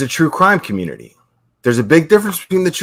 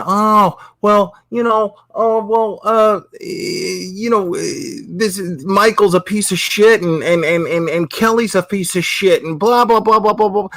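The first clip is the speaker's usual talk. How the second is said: high, mincing imitation of online gossipers; mock the drama crowd in a higher whiny voice; 6 semitones higher than usual. Creator mocking gossipers, high whiny parody voice. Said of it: "sitting here going".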